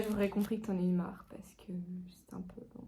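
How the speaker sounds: hushed aside whisper. Real, not acted. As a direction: whisper it off-camera. Aside not meant for camera.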